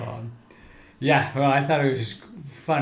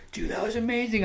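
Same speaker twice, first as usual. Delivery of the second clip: higher, thrilled quoted cousin; excited cousin; 9 semitones higher, 4 dB quieter, faster than usual. Retold or imitated. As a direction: gush about the shot like an amped-up cousin. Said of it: "my cousin James is like".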